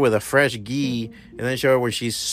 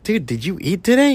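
high, mocking disbelief; asking as if it's obvious; incredulous question; 7 semitones higher than usual. Mock dialogue with whiner.

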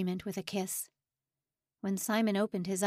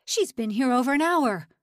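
loud furious shout; raging; 4 semitones higher, 8 dB louder than usual. A narrator's reading. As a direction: yell it in fury. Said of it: "Lang raged".

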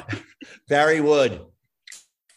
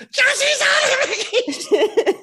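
mock shout; 7 dB louder, much slower than usual. Retold quote, laughing shout.

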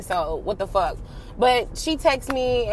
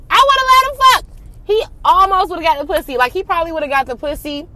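much higher, louder outburst; loud exclaim; 7 semitones higher, 7 dB louder than usual. Real, not acted. Venting about the lying guy, loud exasperated outburst. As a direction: belt it out, exasperated.

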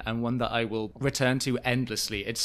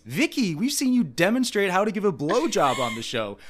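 mock host; 7 semitones higher than usual. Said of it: "Andy then goes".

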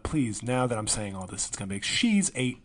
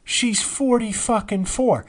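higher, louder, laughing disbelief; incredulous; 10 semitones higher, 7 dB louder than usual. Real, not acted. Vlogger ranting to viewers about the actress playing a teenager, incredulous. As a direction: hammer home how absurd it is. Repeating shocking age.

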